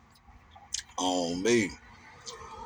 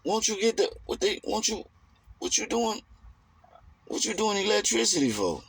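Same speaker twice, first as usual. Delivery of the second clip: put-on high, fast, baffled older relative grilling him; mock-baffled relative voice; incredulous relative; 9 semitones higher, faster than usual. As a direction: act out the relative who can't believe his career choice. Relative questioning his electrician job.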